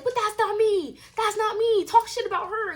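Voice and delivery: high, pleading protest, retold; exasperated high-pitched self-defence; indignant protest. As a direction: replay yourself objecting, voice pushed way up. Acting out protest on phone.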